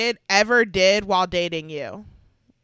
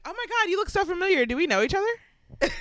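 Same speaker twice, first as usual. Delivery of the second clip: excited gushing pickup line; 9 semitones higher than usual. Pretend.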